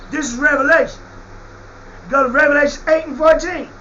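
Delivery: ordinary talk, nothing marked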